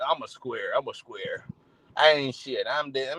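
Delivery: low, soft, moping voice; mocking self-pity. Preacher mimics people who put themselves down, mumbling low and soft. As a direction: mope like a loser running himself down. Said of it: "when people joke on themselves".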